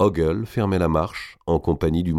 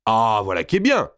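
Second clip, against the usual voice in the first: raised-pitch smug exclamation; gloating approval; 4 semitones higher, 3 dB louder than usual. A narrator's reading. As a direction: relish the captive's confusion. Captor gloats from his horse. (satisfaction moqueuse)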